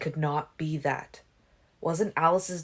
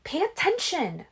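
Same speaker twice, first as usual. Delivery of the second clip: hissing; 14 semitones higher, 3 dB louder than usual. A narrator's reading. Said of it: "Rose hissed at him".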